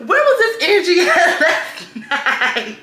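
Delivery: loud, high laughing burst; laughing hard. Real, not acted. Laughing loudly to viewers about his texts. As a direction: crack up at the nerve of him.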